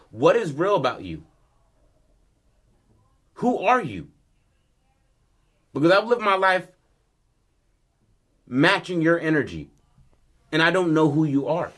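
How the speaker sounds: acted-out outburst, pressing each phrase; heated confrontation. Son confronting his father about who he is, heated.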